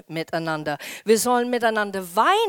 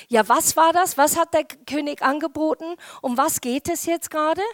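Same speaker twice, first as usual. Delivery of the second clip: higher-pitched eager questioning; curious young David, lightly acted; 6 semitones higher than usual. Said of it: "weil David geht und sagt". (leicht gespielter, neugierig fragender David)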